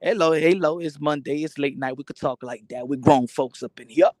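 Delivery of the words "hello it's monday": usual speaking voice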